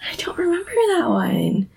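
hushed aside; whisper. Real, not acted. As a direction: breathe it, no voice.

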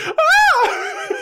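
high, loud laughing mock scream; startled scream. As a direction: shriek in mock shock, cracking up. [name] startled by mailbox.

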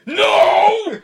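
very loud, high yell; mock furious shout. Cashier answering worried customer, hammed-up furious shout. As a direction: blast it out in a hammed-up meltdown.

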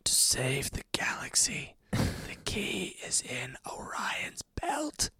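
breathy secret whisper; dramatic whisper. Acted. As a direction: whisper it like a mystic. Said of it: "Did that come through on the headphones".

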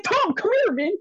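high urgent call to a friend; excited call. Retold or imitated. Fan calls his friend Tom onstage, high and excited. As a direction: pull your buddy over, thrilled.

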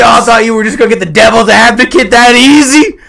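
high, loud, incredulous rant; mock outrage. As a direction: shout it down at the doubters. Mock ranting at audience.

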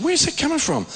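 high-pitched mock bewilderment; bewildered. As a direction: swoop up high as if searching for the source. Acts out his bewildered reaction.

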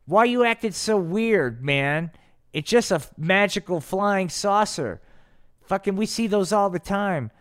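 high-pitched, incredulous brush-off; dismissive Rudolph. Acted. Rudolph brushes off Bigfoot's alarm.

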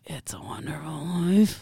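hushed conspiratorial whisper. Acted. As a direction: breathe the title like a secret. Whispered title, partner laughs.

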